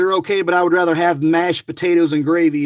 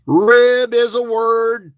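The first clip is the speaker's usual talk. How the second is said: usual speaking voice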